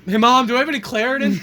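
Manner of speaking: put-on a child calling to his mom for allergy pills; mock kid asking mom. Friend mocking the faker as a kid calling his mom.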